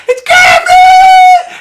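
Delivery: very high, loud, drawn-out shriek; hammed-up excited screaming, stretched long; imitated scream. Mother spotting her son in formation, mimicked shriek. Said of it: "screaming and yelling".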